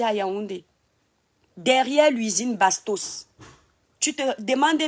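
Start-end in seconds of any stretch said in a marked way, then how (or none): 1.6-3.3 slow, pointed emphasis so it sinks in; drawn-out insistence; slow and deliberate. Real, not acted. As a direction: spell out each word slowly. Tells viewer where she grew up.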